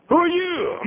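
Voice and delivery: very high-pitched, sustained challenge; insistent questioning. Acted. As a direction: demand it again, far up in pitch. Same question repeated in escalated form.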